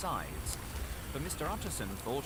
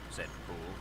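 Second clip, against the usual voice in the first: usual speaking voice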